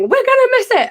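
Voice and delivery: high-pitched imitated panic; Becky panicking. Friend panicking in traffic on way to concert, frantic. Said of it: "Becky was losing her shit".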